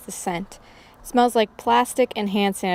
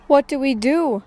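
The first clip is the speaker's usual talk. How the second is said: worried; 5 semitones higher than usual. A narrator's reading. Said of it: "my mom asks worriedly".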